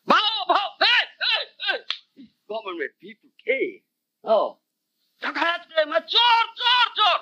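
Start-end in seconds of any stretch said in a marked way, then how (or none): 0.1-2.3 high yapping bark, bhau bhau; dog bark imitation. Teacher imitating the guard dog barking at night. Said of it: "And the dog barks". 5.2-7.2 crying thief. Retold or imitated. Calling out against thieves.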